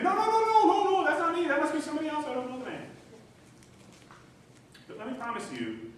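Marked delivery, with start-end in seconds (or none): 0.0-2.9 high, loud, frantic denials fading down; panic, then settle into the lie. Retold or imitated. Peter frantically denying Jesus, loud and high.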